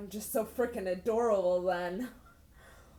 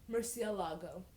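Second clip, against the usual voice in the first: usual speaking voice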